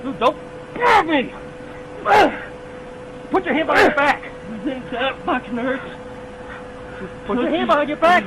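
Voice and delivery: usual speaking voice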